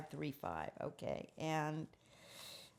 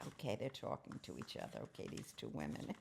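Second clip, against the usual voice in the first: hushed aside, fast at the end; whisper; 13 semitones lower, 10 dB quieter, faster than usual. Real, not acted. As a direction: drop to a whisper. Whispered aside about diary picture.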